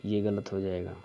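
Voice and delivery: normal talking voice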